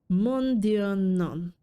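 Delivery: drawn-out French refusal, falling at the end; French-accented cat turning down an offer. Acted. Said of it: "how to do a French accent".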